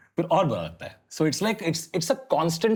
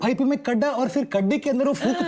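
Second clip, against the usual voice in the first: high, animated mock South Indian storyteller; excited comic South Indian imitation; South Indian accent; 11 semitones higher, faster than usual. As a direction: rattle off the baffled description in a South Indian accent. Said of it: "South Indian man describing".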